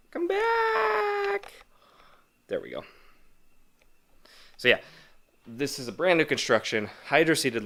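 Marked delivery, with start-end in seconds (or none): 0.1-1.5 exasperated shout. Real, not acted. Calling out to the camera light.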